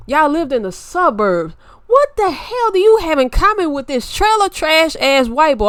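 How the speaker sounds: loud, high scolding tirade. Retold or imitated. Scolding her cousin over the man she chose, loud and high. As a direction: tear into her for her choice of man. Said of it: "I don't mean to raise my voice".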